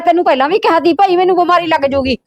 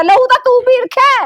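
high, heated retort; quarrelling at raised pitch; angry accusation; 7 semitones higher than usual. Acted. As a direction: fling the blame back at him. Wife hurls accusation back.

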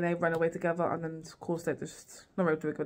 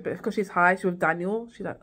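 ordinary talk

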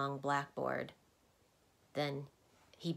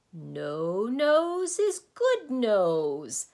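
huge, drawn-out, higher roar; thundering; 7 semitones higher, 11 dB louder, much slower than usual. A narrator's reading. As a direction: thunder it out very slowly. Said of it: "thundered the din once again".

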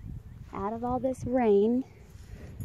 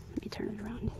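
soft whisper close up; 12 dB quieter, much faster than usual. Real, not acted. Whispering while the horse hugs her. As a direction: breathe it while he hugs you.

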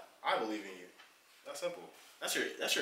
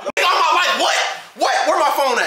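loud high demand; shouting; 13 semitones higher, 16 dB louder than usual. Real, not acted. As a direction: yell for the phone. Heated argument.

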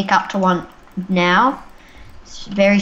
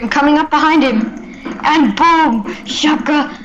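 excited shout; 6 semitones higher, 5 dB louder than usual. Real, not acted. Sneaking attack, kill.

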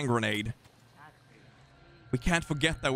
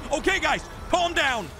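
high-pitched panicked yelling; panicked screaming; slower than usual. Real, not acted. Ambushed by villagers, screaming.

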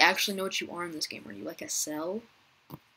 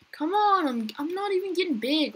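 frustrated whine; 10 semitones higher, 5 dB louder than usual. Real, not acted. Frustrated at small size.

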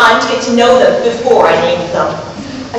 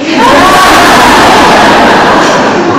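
loud laughing; 7 semitones higher, 7 dB louder than usual. Real, not acted. Laughing at own story.

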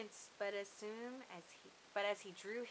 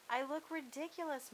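protesting; 7 semitones higher than usual. A narrator's reading. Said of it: "Mona protested".

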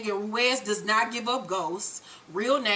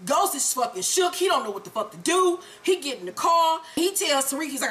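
ordinary talk, nothing marked

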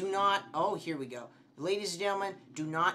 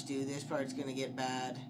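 ordinary delivery

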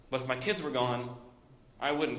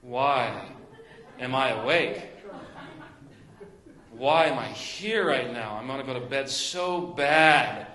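louder, slow, fed-up whine with a laugh; whiny complaining; 5 dB louder, slower than usual. Retold or imitated. Tired mom vacuuming at night, complaining in a loud fed-up whine. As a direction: moan it like you're worn out and done. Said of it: "I was like this".

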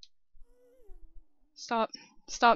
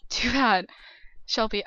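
ordinary delivery

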